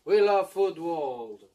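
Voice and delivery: booming show slogans, drawn out; hammed-up TV host shout; loud slogan calls.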